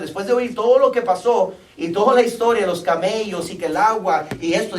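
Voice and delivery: loud, high, urgent preaching from the pulpit; fervent sermon climax; full-force preaching. Acted. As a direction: preach it out to the congregation, pressing hard.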